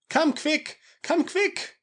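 very high, squealing plea for help; terrified falsetto begging; panicked cry. A narrator's reading. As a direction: cry out frantically in a put-on high voice. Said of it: "he cried".

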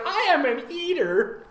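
high, solemn mock declaration; proud parody.

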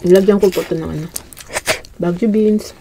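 speaking with food in mouth; slightly muffled chewy speech; talking while chewing. Real, not acted. Seen 1: Woman holding bean speaks; lips pursed, working food while talking.

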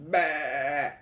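loud drawn-out baa; sheep's bleat. Acted. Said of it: "A sheep says".

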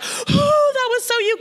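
high-pitched tearful awe; imitated crying. Minister off the phone marvelling to God, imitated crying. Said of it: "I am crying and I'm like".

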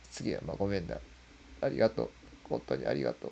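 quiet inner voice. Retold or imitated. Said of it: "心の中で".

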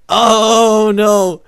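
long, high, loud wail; stretched shout of dismay; dismayed yell. Real, not acted. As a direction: cry out as it all goes wrong. Overshoots the flip.

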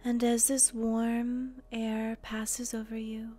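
guided meditation. Acted.

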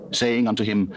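usual speaking voice

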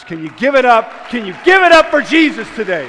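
loud rousing call to praise; shout. Real, not acted. Preacher rallying the church with a loud shout. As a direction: lift the room with a loud shout.

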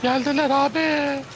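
excited high shout. Real, not acted. Enemies arrive, shouted squeaky.

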